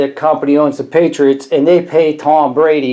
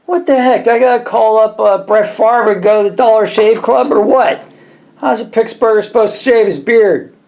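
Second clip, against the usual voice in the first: mock exasperation; 6 semitones higher than usual. Exasperated over razor colours.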